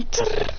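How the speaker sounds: high trilled impact noise; imitated clash. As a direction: make a high rattling clash sound.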